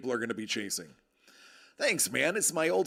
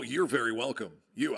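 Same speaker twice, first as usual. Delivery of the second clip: normal talking voice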